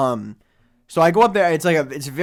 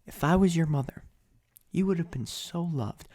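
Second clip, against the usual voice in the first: mock slam poetry; 7 dB quieter than usual. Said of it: "slam poetry saying".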